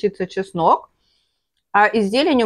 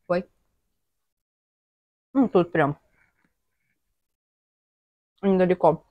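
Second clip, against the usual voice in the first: talking between bites; chewing while talking. Real, not acted. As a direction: mouth partly full. Seen 1: Chewing visible throughout after taking a bite at -2 seconds.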